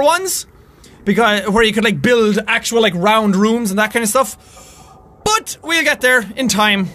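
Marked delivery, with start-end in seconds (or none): none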